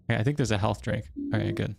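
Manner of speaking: ordinary talk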